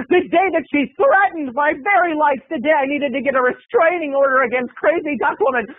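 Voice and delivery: high, dramatic build-up; hammed-up outrage at a mortal threat; mock melodrama.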